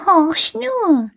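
high, slowing whisper; hushed little cat. A narrator's reading. The cat whispers to Häwelmann, high and hushed. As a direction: hush him like a cat listening to snoring. Cat voice hushing, listening to sleepers.

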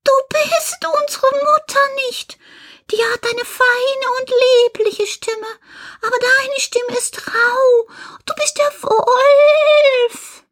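very high, squeaky little-goat voice; goat kids calling out. Acted. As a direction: squeak the accusation, stretching it into a final cry. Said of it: "riefen sie". (piepsig rufende Geißlein)

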